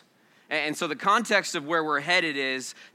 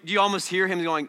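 usual speaking voice

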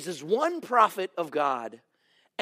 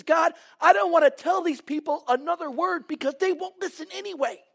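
higher, exasperated prophet's complaint; fed-up complaint; 11 semitones higher, 4 dB louder than usual. Retold or imitated. Preacher voicing Jeremiah, sick of the people, exasperated. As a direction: voice Jeremiah's weary frustration. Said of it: "And Jeremiah is sick of it".

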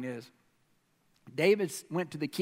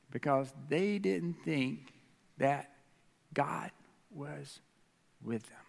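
slow, softening, word by word; grave, quiet punchline; slow dramatic reveal; 5 semitones lower, 5 dB quieter, slower than usual. Real, not acted. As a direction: let each word drop and fade. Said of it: "slow dramatic reveal".

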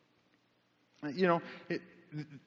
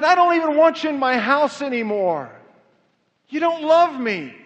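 raised, forceful voice of rejection; wounded rebuke; 5 semitones higher, 7 dB louder than usual. Acted. The Lord to unfaithful Israel, loud wounded rebuke. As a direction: cry out the accusation, high and loud.

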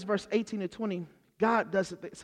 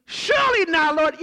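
much higher and louder, an outraged cry; shocked refusal; 9 semitones higher, 8 dB louder than usual. Acted. Peter refusing the unclean food, loudly aghast. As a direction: recoil as if offered something foul. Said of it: "He put an exclamation mark".

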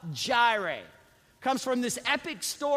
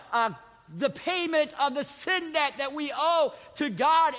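impassioned, higher-pitched pulpit delivery; full-force preaching; 8 semitones higher, 7 dB louder than usual. Real, not acted. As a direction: drive each phrase home to the congregation.

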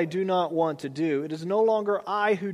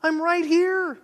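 much higher, exasperated call; God calling out; 10 semitones higher than usual. Retold or imitated. Preacher voices God calling to a frustrated believer, exasperated high call. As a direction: call to him like an exasperated parent. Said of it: "And God's like".

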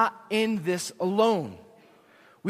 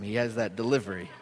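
ordinary talk

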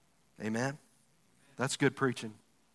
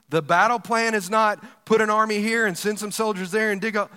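ordinary delivery

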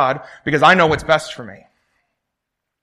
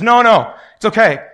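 higher, louder brushing off the helicopter; mock stubborn refusal; 5 semitones higher, 5 dB louder than usual. Drowning man refusing the helicopter rescue, loud and breezy. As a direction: wave them off cheerfully.

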